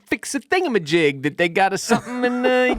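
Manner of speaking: high, airy carefree mockery; mock breezy Walker. Mocking Walker leaving, high careless voice. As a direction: brush it off in a silly high voice. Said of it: "she's like".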